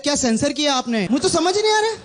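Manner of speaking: baffled, pitched well up; a squeaky bewildered question; confused high voice. Retold or imitated. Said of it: "And I was like".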